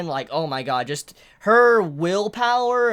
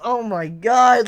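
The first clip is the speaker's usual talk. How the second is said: ordinary delivery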